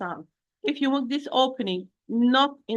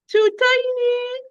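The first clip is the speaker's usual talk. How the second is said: very high, drawn-out squeak through a tiny opening; tiny mouth opening; 13 semitones higher, 4 dB louder, slower than usual. Shown as a demonstration. Teacher mimics a wrong, too small mouth opening, high drawn-out voice. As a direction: squeeze it out high and slow. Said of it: "the wrong opening of the mouth".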